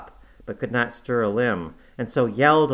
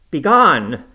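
loud, higher banishing shout; shouted dismissal; 5 semitones higher, 11 dB louder than usual. Acted. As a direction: drive the ghost away with a yell. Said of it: "I shouted".

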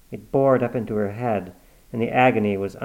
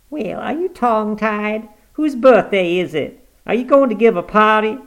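put-on higher, louder peevish old mother; cranky old woman; 11 semitones higher, 8 dB louder than usual. Granny complaining about her face being washed, peevish. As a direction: grumble like a stubborn invalid.

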